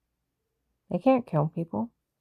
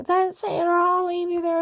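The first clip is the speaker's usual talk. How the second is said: much higher, mocking imitation of the agent; hammed-up cop demand, pitched way up; imitated agent ultimatum; 12 semitones higher, 3 dB louder than usual. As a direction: parody the agent's threat in a raised voice.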